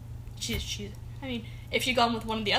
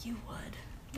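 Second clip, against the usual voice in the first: whispering; 13 dB quieter than usual. Real, not acted. Teasing aside about cuss words.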